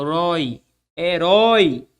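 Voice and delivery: "roi" picked out alone, then "Heróico" with RÓI held; stressed syllable shown. Shown as a demonstration. Teacher locating the tonic syllable, RÓI stressed in "Heróico". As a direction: lean long on the RÓI in "Heróico". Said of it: "Onde é a sílaba tônica de heróico?".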